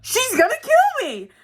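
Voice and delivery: very high, loud mock-terrified cry; hammed-up high-pitched panic, drawn out; panicked squeal. Retold panic at the threat.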